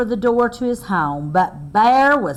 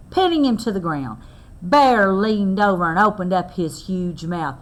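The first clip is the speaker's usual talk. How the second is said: usual speaking voice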